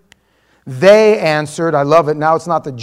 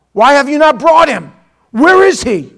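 raised, loud interrogation; angry demand; 7 semitones higher, 8 dB louder than usual. Acted. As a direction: demand an answer like an outraged authority. Pharisees angrily question the officers.

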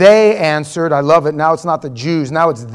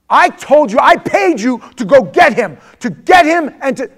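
raised, loud rebuke; angry scolding; 6 semitones higher, 6 dB louder than usual. Acted. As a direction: berate your hired men. Pharisee scolds the officers.

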